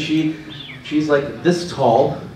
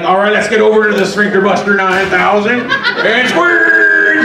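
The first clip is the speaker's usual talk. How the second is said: trainer voice; 3 semitones higher, 4 dB louder than usual. Pretend. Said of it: "He's not gonna be like".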